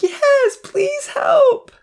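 very high, loud desperate wail. A narrator's reading. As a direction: wail the plea at the top of your range. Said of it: "Rosa wailed".

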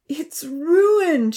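high, loud crying wail; girl bursting into tears on the hilltop. A narrator's reading. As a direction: wail at the ruined valley. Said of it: "she wailed".

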